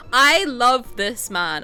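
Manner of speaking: slow, loud and swooning; loud, slow declaration of love; gushing. Real, not acted. Gushing over her fictional husband.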